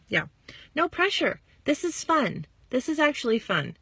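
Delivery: ordinary talk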